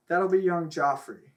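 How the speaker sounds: ordinary talk, nothing marked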